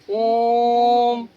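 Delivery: ओम stretched very long on one level note; extra-long vowel. Shown as a demonstration. Teacher demonstrating a prolonged vowel, ओम held very long. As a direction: hold the ओ of ओम far beyond normal, very slowly. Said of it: "दुगुना मतलब दीर्घ समय लगता है".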